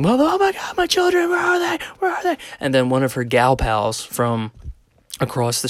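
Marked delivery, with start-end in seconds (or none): 0.0-2.4 very high, strained mock scream; imitated screaming. Man imitates neighbor searching for her children, high screaming. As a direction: mimic a panicked mother wailing for her children. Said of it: "she's screaming".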